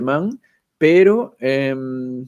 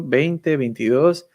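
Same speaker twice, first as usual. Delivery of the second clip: ordinary delivery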